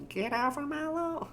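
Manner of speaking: put-on very high, soft old person squeak; trying a tiny high old person voice; old person in squeaky falsetto. Shown as a demonstration. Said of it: "how does an old person talk?".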